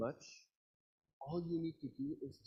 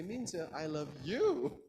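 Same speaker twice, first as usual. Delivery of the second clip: "you" stretched long at the end; stress on you; 3 semitones higher, 3 dB louder, slower than usual. Shown as a demonstration. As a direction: save the weight for a long final "you". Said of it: "the one that I love is you".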